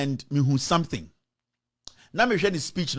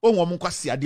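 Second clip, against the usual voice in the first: ordinary delivery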